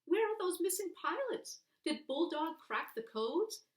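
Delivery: put-on high, loud boyish pleading; a small boy who can't wait for the answer; boy pressing for the end of the story, high and eager. A narrator's reading.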